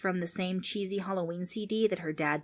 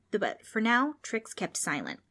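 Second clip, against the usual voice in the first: plain reading voice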